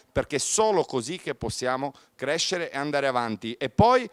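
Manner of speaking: usual speaking voice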